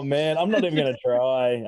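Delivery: ordinary delivery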